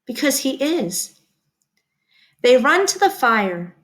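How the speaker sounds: plain reading voice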